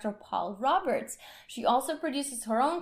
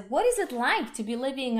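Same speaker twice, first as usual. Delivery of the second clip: ordinary talk, nothing marked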